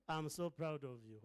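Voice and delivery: ordinary talk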